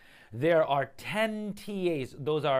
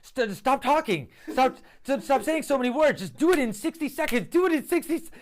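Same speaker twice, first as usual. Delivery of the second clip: hammed-up exasperated orders, much higher and louder; mock irritated; 9 semitones higher, 4 dB louder than usual. Imitating a hungry colleague cutting off long takes, mock exasperated. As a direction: play the fed-up colleague, pitch up, pushing hard. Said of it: "he was so irritated".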